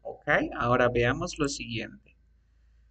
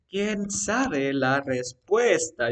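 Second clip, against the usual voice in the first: slow, drawn-out example question; slow dictation; 2 semitones higher, 5 dB louder, much slower than usual. Shown as a demonstration. Teacher spells out an example question for the student, slow dictation. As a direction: stretch each word out as if dictating.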